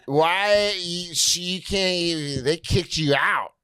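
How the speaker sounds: put-on high, slow, slurring drunk impersonation; drunk lady. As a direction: drag it out like an upset drunk woman. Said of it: "this drunk lady walks up".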